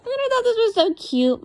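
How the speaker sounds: high, loud squeal of delight; gushing. Real, not acted. Gushing over her doodle to viewers, high and loud. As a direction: squeal over how cute it is.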